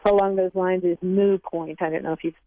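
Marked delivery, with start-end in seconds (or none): 1.0-1.8 "moo point" with the t dropped from moot; "moo point", open oo and no t; incorrect variant. Shown as a demonstration. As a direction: say "moo" like a cow's moo before "point". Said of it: "the other one that's not correct".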